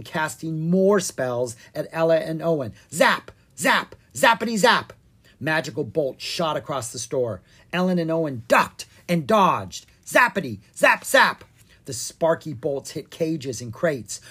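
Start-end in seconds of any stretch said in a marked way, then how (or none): 2.9-11.4 high, loud mock zaps; zapping sounds. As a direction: fire off zaps like bolts. Spell sound effects.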